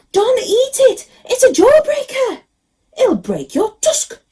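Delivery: a little mouse yelling a warning, slowing at the end; alarmed mouse. A narrator's reading. Mouse warns Ganesha urgently.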